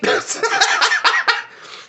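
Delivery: breathless squealing laugh; helpless silent laughter; high wheezing laugh. Real, not acted. Laughing at own Peacemaker line, high wheezing laugh. Cracking up after impression.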